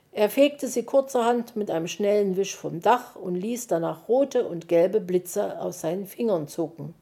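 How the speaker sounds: ordinary delivery, nothing marked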